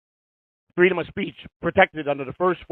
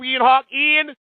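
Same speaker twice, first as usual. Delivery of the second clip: taunting call-out; 8 semitones higher, 3 dB louder, much slower than usual. Real, not acted. Taunting Ian by name.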